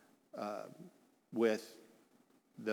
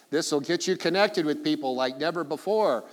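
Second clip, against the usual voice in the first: higher, louder sales-pitch promise; mock hype; 4 semitones higher, 7 dB louder than usual. Preacher mocks technology's promise of connection, hyped up. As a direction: oversell it like an advert.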